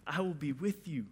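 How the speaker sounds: soft, low, gentle reassurance; a quiet, tender God answering; hushed voice of God. Acted. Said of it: "God's answer is".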